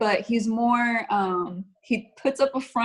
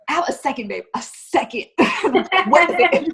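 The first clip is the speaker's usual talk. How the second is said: hurried, higher, louder, a little exasperated; impatient rush; 6 semitones higher, 8 dB louder than usual. Retold or imitated. Wife rushing with makeup answers husband's call, impatient and hurried. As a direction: brush him off while rushing out the door.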